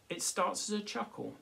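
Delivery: ordinary delivery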